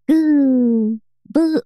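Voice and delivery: high-pitched imitation of baby coos; baby cooing. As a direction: coo like a happy little baby. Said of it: "babies make, like, all these, like".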